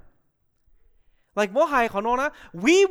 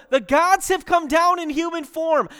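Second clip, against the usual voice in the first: mock shout, higher and louder; retold crowd shout; 5 semitones higher, 5 dB louder than usual. Crowd hailing two men as gods, mild shout. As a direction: call out as the astonished crowd. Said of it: "They start shouting".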